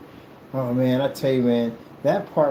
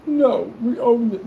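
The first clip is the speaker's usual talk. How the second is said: high, drawn-out mock protest; exaggerated, louder indignant imitation; mocked angry objector; 10 semitones higher, 4 dB louder, slower than usual. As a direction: whine back as the mad objector. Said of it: "You got mad".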